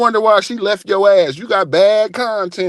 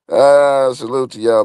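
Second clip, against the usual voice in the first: ordinary talk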